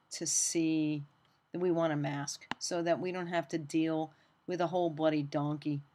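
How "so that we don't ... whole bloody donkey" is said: ordinary talk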